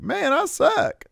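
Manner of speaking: high, slow whiny voice of a defeated wrestler; mock Cameron Grimes. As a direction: stretch it out, way up high, sulking. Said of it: "he's like".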